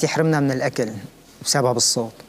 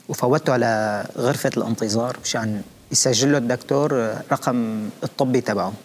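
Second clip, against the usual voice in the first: ordinary delivery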